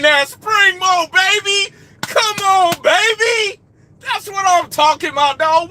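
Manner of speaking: high, loud hype shout; excited hype. Real, not acted. Cheering a viewer's red truck on the live, excited shout. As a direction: hype up the red Pete like a fan.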